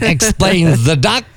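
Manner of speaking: usual speaking voice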